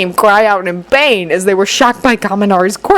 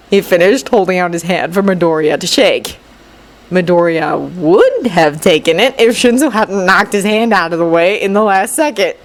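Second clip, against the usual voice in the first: ordinary delivery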